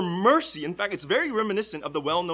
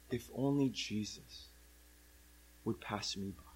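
hushed private wish, spoken low; quiet wishful thought; 7 semitones lower, 10 dB quieter than usual. Acted. Blind beggar thinking to himself as he lies in the street, quiet wishful thought.

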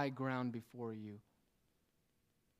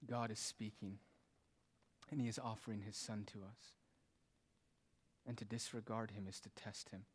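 low, soft sermon voice with weighty pauses; hushed, solemn preaching; 3 semitones lower, 7 dB quieter than usual. Real, not acted. Preacher warns churchgoers of God's wrath, hushed solemn preaching. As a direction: bring it right down, gravely warning.

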